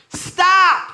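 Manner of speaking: sudden loud, high shout; yelled command. Acted. Imagined friend ordering the wildfires away, yelled command. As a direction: yell it out as one sharp order. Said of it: "when he just started yelling".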